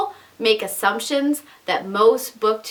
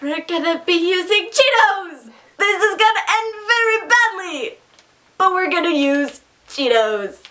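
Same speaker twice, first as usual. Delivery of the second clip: excited; 9 semitones higher than usual. Real, not acted. Announcing Cheetos for the challenge.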